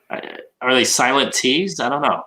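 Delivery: ordinary talk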